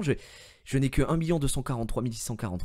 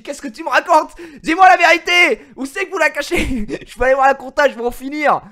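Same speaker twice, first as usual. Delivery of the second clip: much louder and higher, demanding the truth; hammed-up fury; 13 semitones higher, 14 dB louder than usual. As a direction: interrogate him like a cop who's lost it. Mock interrogation of Olivier. (fureur exagérée, très fort)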